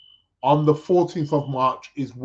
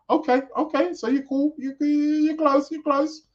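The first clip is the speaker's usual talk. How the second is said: excited; 11 semitones higher than usual. Real, not acted. Excited about nearby listener.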